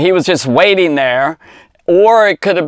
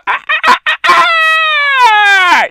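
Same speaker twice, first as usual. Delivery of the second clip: high, sharp mock cock-a-doodle crows; rooster crow imitation; 3 dB louder, slower than usual.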